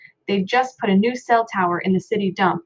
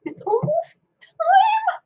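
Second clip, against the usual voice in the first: much higher and slow, voice trembling with excitement; quivering with enthusiasm; much slower than usual. A narrator's reading. Little brother on the piano bench, high, quivering excitement. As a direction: barely hold it in, quivering high. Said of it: "his voice quivering with enthusiasm".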